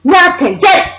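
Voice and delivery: high, loud frantic mother impression; mock hysterical scolding, held slightly back; imitated screaming. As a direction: screech urgent orders as the panicked mother. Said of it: "she started screaming".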